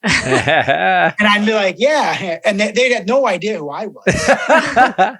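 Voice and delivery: laughing through the story, slowed down; chuckling while recounting the mix-up with strangers. Real, not acted. Telling a friend how strangers knew only his dog, laughing.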